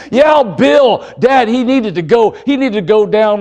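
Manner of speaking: acted son justifying himself; defensive son. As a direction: plead your case to Dad. Acts son pleading his excuse.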